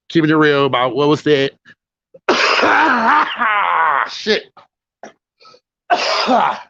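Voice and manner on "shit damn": harsh smoker's coughing fit. Real, not acted. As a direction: cough hard, then curse. Choking on cigarette smoke.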